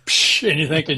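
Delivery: ordinary talk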